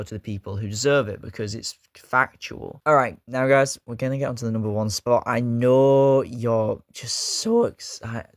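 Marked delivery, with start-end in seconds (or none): 5.3-8.3 drawn-out hype with the voice cracking; real crack at the big moment. Real, not acted. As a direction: let the voice crack on the excited build-up. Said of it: "Was that a voice crack?".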